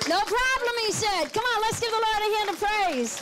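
preacher rallying the congregation to praise; jubilant praise. Real, not acted. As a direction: lift the crowd up in celebration. Preacher celebrates healing, rallies crowd.

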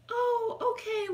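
high, airy "oh, okay"; a casual coworker answering, voiced high; mimicking the coworker. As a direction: pitch up like the surprised coworker.

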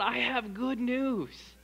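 slow, high, ringing good news; joyful proclamation. Shown as a demonstration. Preacher tells church to announce good news joyfully. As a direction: proclaim it with joy. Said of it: "that excitement and that joy".

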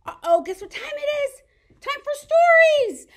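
high, loud, bright announcement; gasping with excitement; excited announcement. Acted. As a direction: surprise the kids with story time.

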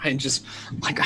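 normal talking voice

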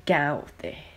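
ordinary delivery

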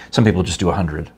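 ordinary talk, nothing marked

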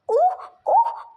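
high loud mock sobbing; crying sobs. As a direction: sob out loud like a hurt child. Child cries after being scolded. (yüksek sesle ağlayan çocuk)

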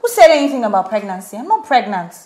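loud, protesting denial; indignant denial. Acted. Daughter correcting her mother's guess, indignant. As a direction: shut the idea down loudly.

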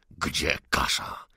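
very slow, low, breathy threat; menacing demand. Acted. Gunman repeats his demand to Salomea, slow and menacing. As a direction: drag out the question like a threat.

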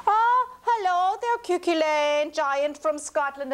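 a hint of a wife sweetly greeting a giant.

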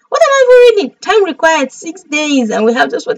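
high, loud squeal easing down; flustered excitement. Real, not acted. Streamer muddling what she reads, flustered high squeal. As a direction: blurt it high, then start settling. Excitedly losing track while reading.

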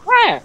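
very high, loud "rah"; imitated creature squeal. Showing off a creature impression, very high loud squeal. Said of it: "spot on perfect impression".

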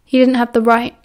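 usual speaking voice

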